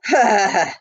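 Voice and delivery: a short chuckle from the road agent; amused outlaw chuckle; hearty laugh. Acted. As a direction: laugh it off easy. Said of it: "the man laughed".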